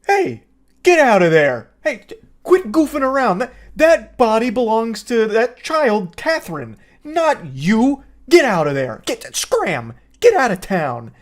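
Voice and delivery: loud, slow scolding of the demon; mock stern scolding. Mother shouting down the possessed child's demon, hammed-up scolding. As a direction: yell at it like a cross parent. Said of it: "her strategy is".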